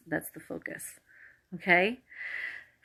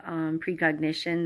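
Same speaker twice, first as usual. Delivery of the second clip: ordinary talk, nothing marked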